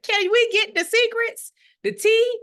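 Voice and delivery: high, loud, excited begging; imitating an eager elder; eager gossip. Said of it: "they be like".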